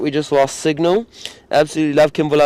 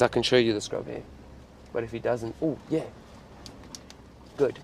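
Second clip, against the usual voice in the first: hushed voice; 13 dB quieter, faster than usual. Real, not acted. Quietly near animal at night.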